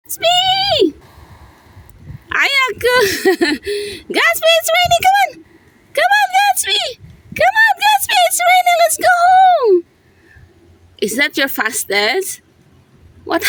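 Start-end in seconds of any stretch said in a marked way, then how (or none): 0.1-0.9 high-pitched calling the dog. Real, not acted. 4.2-9.8 high-pitched coaxing to a dog; high calling. Real, not acted.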